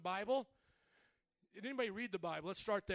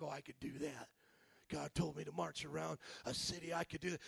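lower, softer cocky brag; mock tough guy; 7 semitones lower, 3 dB quieter than usual. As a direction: mimic a listener's casual bravado quietly. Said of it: "you're like".

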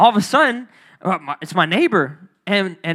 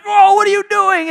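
alarmed shout; 12 semitones higher, 7 dB louder than usual. Retold or imitated. Confronting the gunman.

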